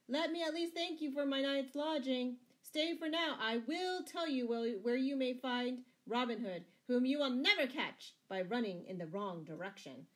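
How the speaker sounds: mock thanks called after someone; jeering. A narrator's reading. Scarlet taunting the fleeing sheriff, jeering. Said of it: "jeered Scarlet".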